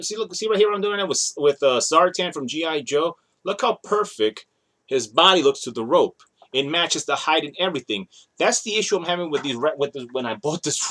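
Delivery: normal talking voice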